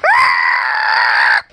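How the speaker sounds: sharp yell as a blow lands; fight yell. Pretend. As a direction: cry out like taking a punch. Fight blow in pretend play.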